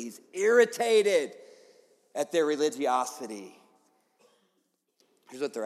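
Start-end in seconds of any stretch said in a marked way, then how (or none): none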